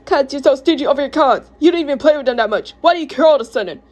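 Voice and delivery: high, heated arguing; angry accusation. Acted. Repeats accusation in quarrel.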